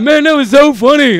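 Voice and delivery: high, loud excited exclamation; hyped-up mock praise; retold crowd enthusiasm. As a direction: imitate a thrilled audience member, loudly.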